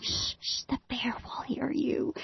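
put-on hushing the child so the bear can't hear; hushing whisper. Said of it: "And we're like".

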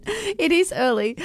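slow, stretched yawn through the words. Real, not acted. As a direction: talk mid-yawn, drawn out. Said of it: "I can't stop yawning today".

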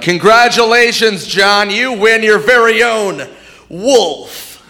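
high, slow announcer voice; hammed-up slow announcer; mock announcer. Mock game show announcer.

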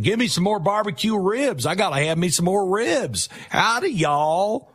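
high hammed-up Texan drawl; fake Texan drawl. Mocking a politician's put-on Texan drawl, high and hammed up. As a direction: ham up a cowboy Texas accent, slow at the end. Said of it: "he's all Texan".

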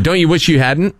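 ordinary delivery

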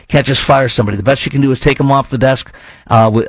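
ordinary talk

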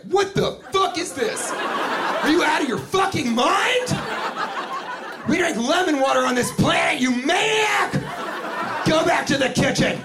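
high-pitched mock furious rant; hammed-up outrage. Customer berating waiter over lime water, outraged. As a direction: screech it, stretching the key words.

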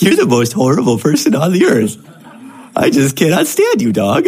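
sweet cooing tone over harsh words; nice-sounding insult. Pretend. Owner insulting his dog in a lightly sweet voice. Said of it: "'cause the inflection was, you know, nice in some way".

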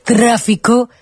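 very slow, stretched-out reporter delivery; amateur take on a dramatic TV reporter; Gloria Serra impression. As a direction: drag out each phrase for investigative suspense. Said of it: "pero lo hago muy mal".

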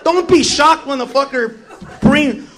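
usual speaking voice

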